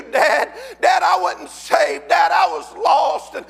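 shouted Southern revival sermon; preaching at full force. Real, not acted. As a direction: preach your testimony at the top of your voice.

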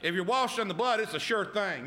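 fervent preacher line; preaching. Acted. Pastor preaching assurance, fervent. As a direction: drive it home to the church.

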